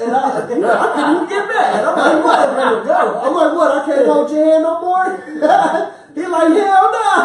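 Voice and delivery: high loud laughter through the words; father laughing at his son's rebuff. Real, not acted. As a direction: keep cracking up while telling it. Laughing retelling of son.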